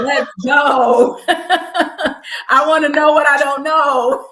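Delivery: loud, high, laughing exclamation; drawn-out, whooping excitement; excited laughing cry. Real, not acted. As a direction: cry out through laughter, eager to go. Laughingly demands bigger trips.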